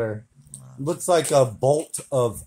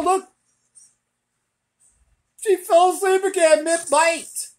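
ordinary delivery

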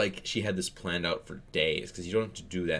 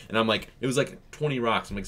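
ordinary delivery

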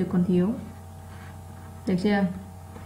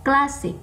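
CLAS in "Classic" punched higher; stressed first syllable; 6 semitones higher than usual. Shown as a demonstration. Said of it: "nó to hơn những âm còn lại". (nhấn mạnh âm một của "Classic")

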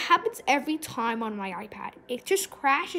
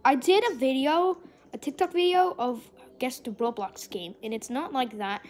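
ordinary talk, nothing marked

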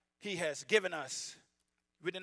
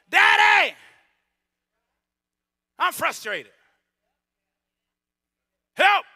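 loud, high plea to daddy; desperate cry for help; 11 semitones higher, 18 dB louder, slower than usual. Pretend. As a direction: call out to Daddy like a child in need. Acting a child crying to God.

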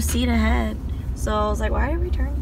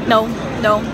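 normal talking voice

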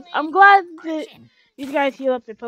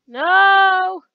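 loud, high cry of "no"; dismayed shout; 5 semitones higher, 13 dB louder than usual. Real, not acted. Pokemon is hit in battle.

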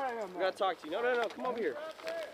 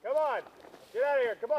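shouting; 6 semitones higher, 4 dB louder than usual. Acted. Calling people down.

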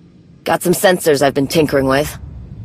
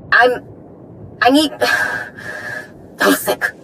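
choked-up and faltering; 8 semitones higher than usual. Acted. Overwhelmed, sighing, breaking off.

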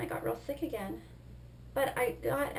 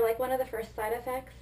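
ordinary talk

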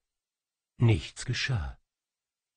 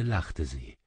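usual speaking voice

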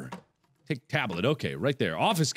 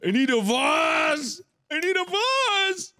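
frantic shout; 11 semitones higher, slower than usual. Real, not acted. Frantic search for a vase.

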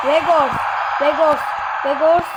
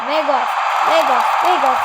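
normal talking voice

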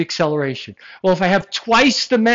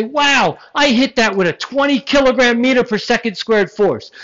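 excited boast; 6 semitones higher, 4 dB louder than usual. Retold or imitated. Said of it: "now I could say".

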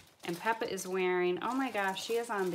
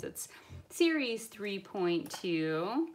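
usual speaking voice

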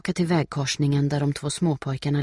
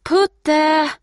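high, loud, sustained call; 13 semitones higher, 11 dB louder than usual. A narrator's reading. Steffi searching for Putte, calling out. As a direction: call a lost dog from far away. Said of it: "ropar hon".

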